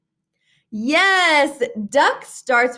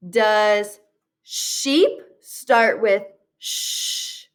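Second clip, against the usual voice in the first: ordinary talk, nothing marked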